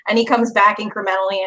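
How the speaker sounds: normal talking voice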